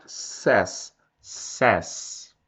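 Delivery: "says" as "sés", open e, no glide; pronúncia correta. Shown as a demonstration. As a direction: model "says" carefully as "sés". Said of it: "Cuidado pra não falar seis". ("says" pronunciado "sés", sem som de i)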